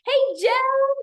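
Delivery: high, loud bright hello to a viewer; excited greeting. Real, not acted. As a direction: call out his name with delight.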